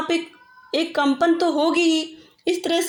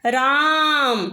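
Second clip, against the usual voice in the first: drawn-out call; much slower than usual. Shown as a demonstration. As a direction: stretch राम long, as if calling someone from afar. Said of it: "जैसे किसी को पुकारने में".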